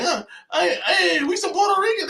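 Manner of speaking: high, giggling put-on voice; hammed-up fake boast at the cookout, laughing; mock Puerto Rican claim. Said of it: "and be like".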